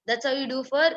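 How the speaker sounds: ordinary talk, nothing marked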